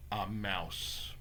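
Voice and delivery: low, very soft narration; hushed reveal. A narrator's reading. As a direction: drop right down for the reveal. Hushed reveal of his new self.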